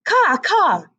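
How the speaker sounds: a raven crying caw from the fence; mock raven caw. A narrator's reading. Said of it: "the raven screamed".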